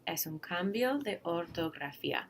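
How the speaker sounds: normal talking voice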